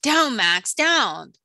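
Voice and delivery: loud, firm dog command. Acted. Prince Eric calling off his dog Max, commanding.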